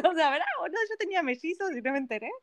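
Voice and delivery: high-pitched, breaking into laughter; laughing disbelief. Real, not acted. Mother joking she had unknown twins, laughing disbelief. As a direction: joke incredulously through a laugh. Laughing over placenta surprise.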